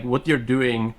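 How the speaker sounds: ordinary talk, nothing marked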